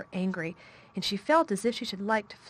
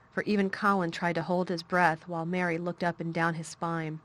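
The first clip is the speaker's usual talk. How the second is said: ordinary delivery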